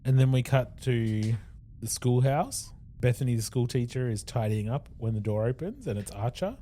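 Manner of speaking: ordinary talk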